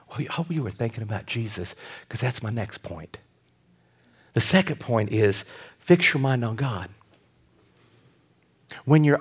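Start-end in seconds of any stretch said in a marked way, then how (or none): none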